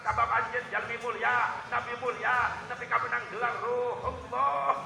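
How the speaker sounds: loud, high-pitched sermon cry to the congregation; fiery preaching. Acted. Preacher acting out the prophets' replies, loud preaching. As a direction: preach it at full force.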